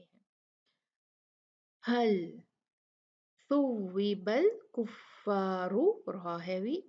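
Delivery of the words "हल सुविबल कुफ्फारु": slow melodic chant; measured Quranic chant; Quran recitation. Shown as a demonstration. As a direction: recite slowly, stretching vowels.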